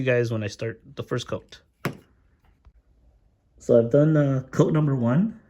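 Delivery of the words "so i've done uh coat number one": muffled behind a paint mask; through a face mask. Real, not acted. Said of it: "If my voice sounds a little funny, it's 'cause I'm wearing a mask".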